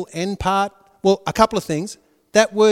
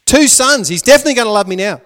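louder, rushing out the hope, fast; eager Leah; 5 semitones higher, 7 dB louder, faster than usual. Retold or imitated. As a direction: sure that this time it works, fast and louder. Voicing Leah's hopeful words.

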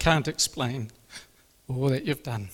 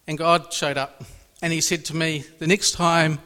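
ordinary delivery

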